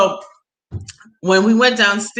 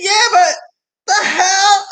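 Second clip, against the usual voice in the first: shocked yell; 12 semitones higher, 5 dB louder, slower than usual. Retold or imitated. Shocked at a corpse display.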